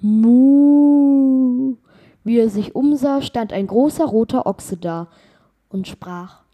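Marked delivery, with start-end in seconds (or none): 0.0-1.8 long, loud, higher mooing call. Acted. Ox answering the traveller's invitation with a loud moo. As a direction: moo out like a big red ox. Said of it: "Da rief es hinter dem Gesellen".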